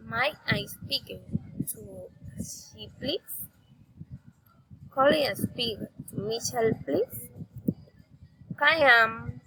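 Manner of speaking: "please" swept sharply upward; rising intonation. Shown as a demonstration. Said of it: "raising intonation".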